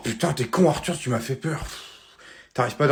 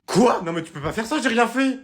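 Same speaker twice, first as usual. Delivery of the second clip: put-on outraged kid; 9 semitones higher than usual. Son protests losing his concert, outraged. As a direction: cry out at the injustice.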